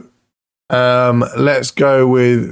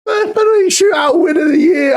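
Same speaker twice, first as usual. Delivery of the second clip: much higher, disbelieving scream of joy; ecstatic shout. Real, not acted. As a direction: scream it as the save goes in. Keeper saves winning penalty.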